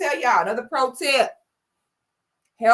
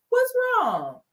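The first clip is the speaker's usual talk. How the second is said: much higher, worried little question; high concerned girlfriend; 11 semitones higher than usual. Retold or imitated. Girlfriend asks her sulking man, worried and much higher. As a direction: play the caring girlfriend asking.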